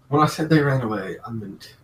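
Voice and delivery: loud dark joke. Acted. Villain delivers dark punchline.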